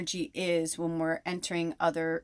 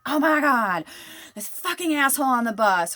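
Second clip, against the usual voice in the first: venting; 7 semitones higher, 6 dB louder than usual. Retold or imitated. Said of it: "be like".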